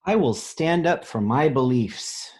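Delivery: bold, proud storybook read; reading a picture book aloud. A narrator's reading. Teacher reading a picture book to children, bold and proud. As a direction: read it out like a brave promise.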